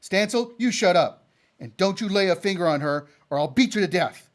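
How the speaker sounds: loud defiant threat; angry. Acted. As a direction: stand up to the bully. Capper stands up to Stancil.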